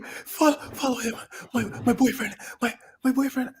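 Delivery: high, stammering imitation of a panicked girl; frantic pleading.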